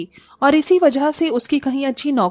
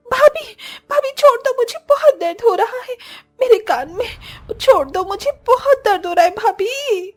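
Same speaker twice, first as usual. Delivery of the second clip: pleading in pain; 12 semitones higher than usual. Acted. Disha begging her sister-in-law to stop twisting her ear, cries of pain. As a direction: beg her to let go of your ear.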